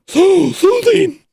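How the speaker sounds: very high, slow, airy put-on voice; drawn out in a silly high soothing tone; inward reading voice. Shown as a demonstration. Streamer reads a chat comment in a mock soothing high voice. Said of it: "I'll read that one more time".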